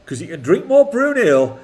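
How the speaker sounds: put-on a light, amateur Geordie accent; a son asks his dad for a Geordie accent, mock Geordie lad. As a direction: put on a hammed-up Geordie lad's voice. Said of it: "say it in a Geordie accent".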